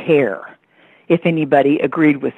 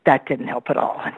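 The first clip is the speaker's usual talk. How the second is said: ordinary talk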